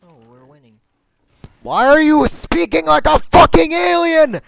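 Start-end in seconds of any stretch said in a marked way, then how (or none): 1.6-4.4 screaming at the top of lungs; furious shout. Acted. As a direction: yell it as loud as you can. Shouted taunt in game.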